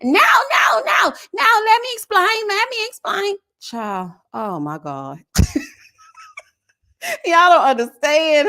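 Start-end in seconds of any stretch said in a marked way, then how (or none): 0.0-3.4 high, loud frantic pleading; mock her panicked, shrill-free over-explaining, loud and high; mocking Alexis. Reviewer mocking Alexis's frantic explaining to her viewers, loud and high. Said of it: "I couldn't take too much of whatever she was saying". 5.3-8.5 laughing. Real, not acted. Laughing at the foolishness.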